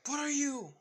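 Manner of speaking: high, loud acted question; startled outcry. A narrator's reading. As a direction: cry out in alarm. Ida startled by blood sucking.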